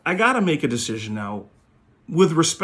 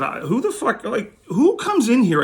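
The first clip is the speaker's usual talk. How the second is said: pitched way up, outraged and slowing down; retold disbelief, going higher and louder at the end; indignant outburst; 10 semitones higher, 4 dB louder, slower than usual. Retold blowup at pushy collector.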